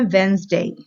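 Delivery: वेडनेसडे said wenz-day, no d; वेडनेसडे with the d silent, wenzdey; correct Wednesday. Shown as a demonstration. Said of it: "हम डी pronounce नहीं करते". (वेडनेसडे बिना डी के, वेन्ज़डे)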